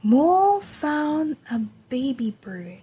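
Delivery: ordinary delivery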